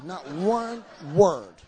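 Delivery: slow, loud, each word stressed; emphatic complaint. Retold or imitated. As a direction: visitors complain they understood nothing. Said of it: "they were saying".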